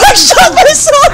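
very high, loud laughing shriek; laugh-scream at your own blunder. Real, not acted. Player laughing in disbelief after shooting himself, shrieking.

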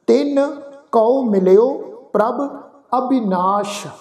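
slow, measured recitation; slow gurbani recitation. Acted. Teacher reciting gurbani verse slowly to students. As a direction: recite each word apart, devoutly.

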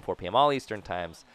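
ordinary delivery

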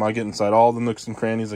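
usual speaking voice

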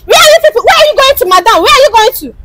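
high, insistent questioning; urgent, repeated challenge; shouted demand. Acted. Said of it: "Why are you shouting?".

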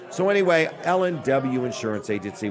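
ordinary delivery

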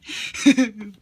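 loud high laugh; loud laughing fit; burst of laughter. Real, not acted. Reader losing it at the story, loud laughing burst. Cracking up mid reading.

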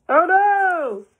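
high, loud hammed-up gasp; a reader playing up fear for the listeners; mock alarm. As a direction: act shocked for the kids. Dramatic reaction while reading.

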